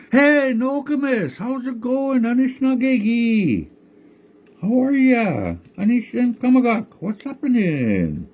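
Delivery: high, slow, friendly tree voice; high cheery tree greeting; tree character voice. Acted. Tree voiced in told story.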